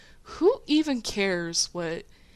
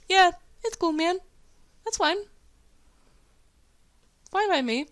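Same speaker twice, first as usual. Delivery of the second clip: smirking reply; 10 semitones higher than usual. Retold or imitated. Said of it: "and just say, like".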